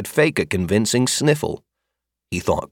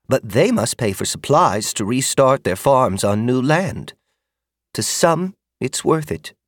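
put-on kindly older woman; 4 semitones higher than usual. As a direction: lay out hard facts gently. Magda explains debt.

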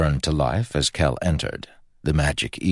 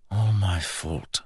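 slow, soft, slightly higher self-blame; remorseful; 4 semitones higher, 4 dB quieter, slower than usual. A narrator's reading. Groggy prince blaming himself, soft and remorseful. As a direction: trail it out slowly and softly.

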